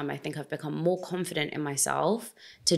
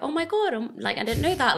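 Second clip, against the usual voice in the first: much higher and bright with excitement, gushing; animated demo; 9 semitones higher than usual. Shown as a demonstration. Showing her natural expressive voice to the host, animated and high. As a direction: show off how animated you really are. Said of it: "I'm just very animated".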